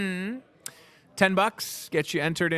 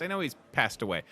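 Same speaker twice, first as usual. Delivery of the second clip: ordinary delivery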